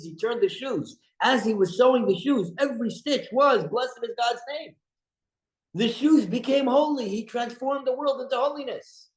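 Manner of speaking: rising pulpit fervor; impassioned preaching. Acted. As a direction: lift the voice and preach the point home. Impassioned moral lesson on Hanoch.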